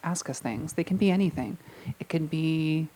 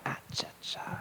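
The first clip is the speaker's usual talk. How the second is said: breathy whispered "Ah, cha, cha"; whispered catchphrase; 13 dB quieter than usual. Pretend. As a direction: hush it like a showbiz secret. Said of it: "[name]'s Ah Cha Cha comes out again".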